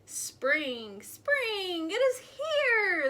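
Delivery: building from plain to a high, loud shout. A narrator's reading. Groundhog shouting loudly into the pig's ear. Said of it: "shouted loudly in the pig's ear".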